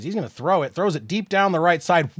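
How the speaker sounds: sportscaster calling the drive; play-by-play. Acted. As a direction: call the play as it happens. Live play-by-play call.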